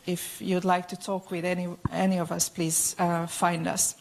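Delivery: usual speaking voice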